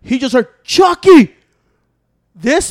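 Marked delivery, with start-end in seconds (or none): 0.6-1.4 loud high call of the dog's name. Retold or imitated. Owner calling his dog in the kennel, loud high call. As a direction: call Chucky across the pound.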